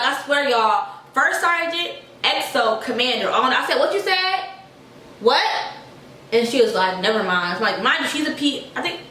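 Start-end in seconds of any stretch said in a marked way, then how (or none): 3.8-5.4 high, pointed challenge; angry voice. Retold or imitated. Repeating it to the private in front of the officers, angry. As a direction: throw the question back higher. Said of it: "I dead had my little angry voice".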